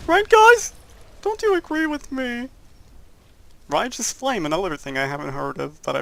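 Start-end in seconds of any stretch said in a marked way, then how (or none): 0.1-0.7 much higher and louder pleading shout; shrill yell. Retold or imitated. Begging viewers to agree, loud high shout. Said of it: "I'm just gonna be like". 1.2-2.5 high, pleading put-on apology; mock apology. Apologizing to an angry Italian commenter, high mock whine. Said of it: "I'm just gonna be like".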